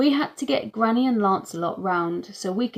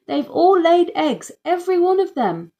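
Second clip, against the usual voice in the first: much higher, louder excited yell; 10 semitones higher, 8 dB louder than usual. A narrator's reading. Mum yells to the house from the hen house, thrilled. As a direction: call the news across. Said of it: "she yelled back to the house".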